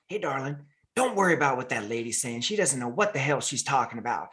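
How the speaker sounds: lightly voiced, gently comforting Southern lady. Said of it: "she just goes".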